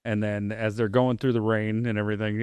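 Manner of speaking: ordinary delivery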